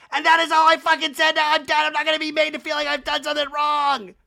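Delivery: high, loud tearful-style tirade; mock screaming. [name] screaming at the group, mock hysterical. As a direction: scream it like a hammed-up meltdown. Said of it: "then she screams more on top of the crying".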